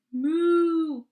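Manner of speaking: loud mooing cow; big loud moo. Acted. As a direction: belt out the moo. Cow voice in read-aloud.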